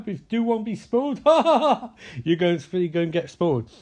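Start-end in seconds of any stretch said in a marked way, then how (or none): 1.3-1.9 loud, high burst of "ha ha ha"; put-on teasing cackle; mock gleeful laugh. Mock-gleeful laugh at spoiler seekers.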